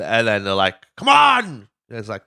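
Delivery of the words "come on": loud, high exasperated yell; mock shout. Urging the reluctant one, a loud high yell. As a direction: bark it out impatiently.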